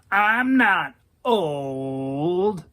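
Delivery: put-on very slow, wavering old-dude warble; shaky warble. Daryl denying he is old to the queen, slow shaky warble. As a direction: draw it out in a trembling warble. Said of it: "in that shaky-ass warble of his".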